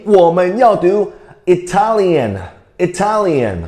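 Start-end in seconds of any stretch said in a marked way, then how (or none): 1.5-3.6 "Italian" slowly, stressed on TAL; stress on second syllable. Shown as a demonstration. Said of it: "在第二个音节".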